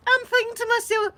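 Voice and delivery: ordinary talk, nothing marked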